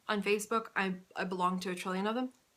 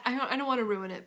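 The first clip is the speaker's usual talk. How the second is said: usual speaking voice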